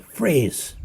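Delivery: high, loud shouted command; yelled freeze. Retold or imitated. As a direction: bark it out like stopping everyone in the room. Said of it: "I just yelled".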